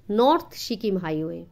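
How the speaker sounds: ordinary delivery, nothing marked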